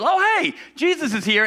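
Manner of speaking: high, bright call out to the street; excited call. Retold or imitated. Martha spotting Jesus on the road, calling out high. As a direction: call out with surprised delight.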